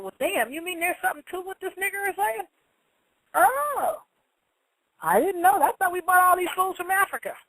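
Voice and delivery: high-pitched mimicked amazement; mock astonishment. Mimics officials' astonished realization.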